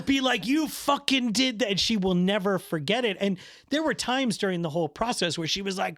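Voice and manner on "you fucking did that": mock accusation from wife. Wife's lasting accusation, retold.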